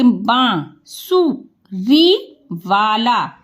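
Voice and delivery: very slow, sounded out piece by piece; slow spelled-out reading; syllable-by-syllable demo. Shown as a demonstration. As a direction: stretch each syllable for a beginning reader. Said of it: "तो इसे क्या बोलेंगे".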